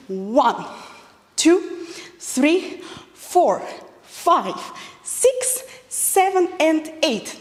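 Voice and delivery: counting the moves aloud; counting the beat. Shown as a demonstration. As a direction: call out the counts rhythmically. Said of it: "not correct variant".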